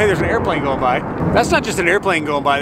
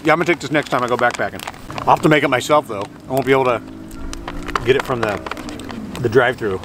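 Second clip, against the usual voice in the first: usual speaking voice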